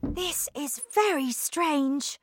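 put-on slow, high child's voice; puzzled child. A narrator's reading. Charlie thinking in the lift, puzzled. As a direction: wonder slowly to yourself. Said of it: "thought Charlie".

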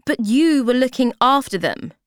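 loud, high protest; loudly. Acted. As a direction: raise the voice at the shop girl. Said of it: "she says loudly".

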